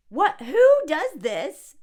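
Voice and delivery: loud, slow disbelieving cry; shocked outcry. Real, not acted. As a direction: react in disbelief. Shocked at wallet.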